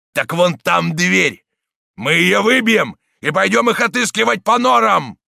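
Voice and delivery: loud, high leader's shouting; rallying harangue. A narrator's reading. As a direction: rouse the mob to attack. Gang leader haranguing the crowd.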